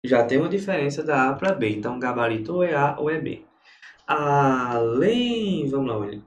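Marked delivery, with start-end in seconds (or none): 4.1-5.7 Além drawn out, leaning on "lém"; Além in two long syllables, "lém" held; stress on the final syllable. Shown as a demonstration. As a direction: stretch Além to expose its last syllable. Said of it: "Oxítona terminada em em".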